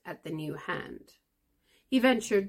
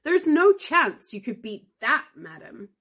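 loud, higher and sure of winning; indignant snap; 9 semitones higher, 8 dB louder than usual. A narrator's reading. Mister Frank insisting to Granny he has won, loud. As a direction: throw it at her, loud and high. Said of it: "snapped Mister Frank".

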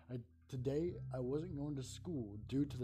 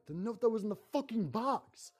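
much higher, loud furious yell; yelled in rage; 9 semitones higher, 7 dB louder than usual. Acted. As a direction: scream it in rage. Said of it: "I yelled with rage".

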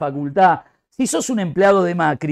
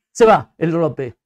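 ordinary talk

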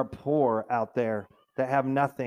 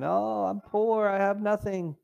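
higher, slow whining voice; hammed-up grumbler; mock complaining; 7 semitones higher than usual. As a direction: whine about being poor. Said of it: "people were complaining".

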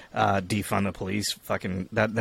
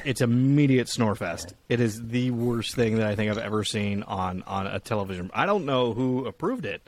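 usual speaking voice

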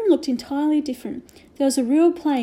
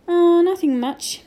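slow, higher, airy brush-off; evasive Omri; 6 semitones higher, 5 dB louder, slower than usual. A narrator's reading. Omri hides the lost key from his mother, slow and evasive. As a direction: hide the worry behind a casual tone.

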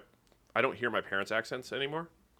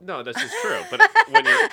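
laughing; 15 dB louder than usual. Real, not acted. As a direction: speak through laughter. Laughing while talking.

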